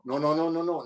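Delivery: usual speaking voice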